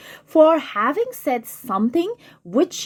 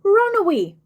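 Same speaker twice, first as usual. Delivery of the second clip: fiend urging; 8 semitones higher than usual. Acted. Teacher voicing the fiend.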